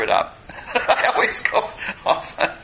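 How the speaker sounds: real laugh breaking into the words. Real, not acted. Teacher laughing at own digressions, laughing. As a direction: chuckle through the admission.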